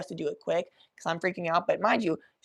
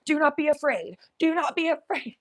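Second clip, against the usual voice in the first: brave command; 9 semitones higher than usual. Retold or imitated. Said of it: "each one she removes is".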